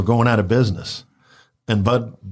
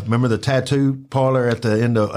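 ordinary talk

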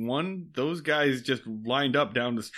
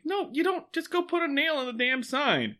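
Andy voice; 12 semitones higher than usual. Retold or imitated. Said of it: "And Andy's like".

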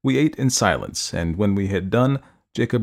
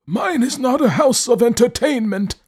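put-on high, haughty old man voice; 13 semitones higher, 3 dB louder than usual. A narrator's reading. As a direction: look down on the stranger, old and proud. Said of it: "he said haughtily".

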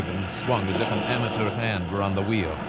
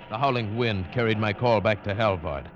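ordinary talk

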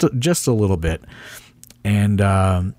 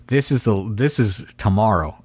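ordinary talk, nothing marked

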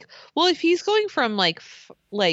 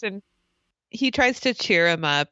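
ordinary talk

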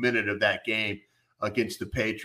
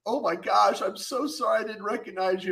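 lightly voiced young woman, much higher; embarrassed mock woman; 8 semitones higher than usual. The girl apologizing to the owner, high mock voice. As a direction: pitch up, apologetic and flustered. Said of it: "She's like".

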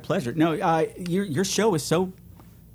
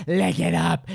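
sultry catchphrase; slower than usual. Shown as a demonstration. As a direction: purr it out, slow and sly. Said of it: "repeated catchphrase, varied".

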